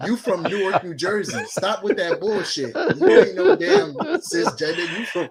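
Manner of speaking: high, loud, laughing scolding; cracking up while calling him out; laughing high rant. Retold or imitated. Scolding his Ghanaian friend, high laughing rant. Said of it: "I said".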